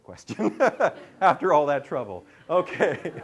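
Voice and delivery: loud, amused, laughing through the words. Real, not acted. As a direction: say it through real laughter, loudly. Laughs at her own remark.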